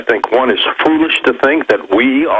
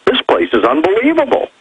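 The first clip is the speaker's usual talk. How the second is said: much higher voice, acting out delighted visitors; imagined visitors' marveling; 11 semitones higher than usual. Guest voicing other inhabitants coming here to visit, high-pitched wonder. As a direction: play an awed tourist, pitched up. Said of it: "other inhabitants are coming here going".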